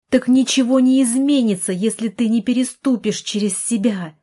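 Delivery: high, loud outburst; sudden shouting. A narrator's reading. Sister suddenly shouts at brother to push himself, loud. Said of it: "Вероника вдруг закричала".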